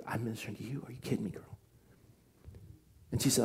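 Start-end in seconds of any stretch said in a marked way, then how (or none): 0.1-1.6 soft unvoiced whisper of amazement; whispered disbelief. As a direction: whisper it, moved and incredulous. Touched aside to a friend.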